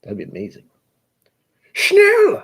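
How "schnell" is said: very high, loud mock German yell; angry German. Shown as a demonstration. Showing the only German he knows, mock angry German shout. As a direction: cry it out like a cartoon angry German. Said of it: "German language is, is equated to anger".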